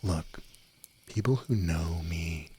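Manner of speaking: ordinary talk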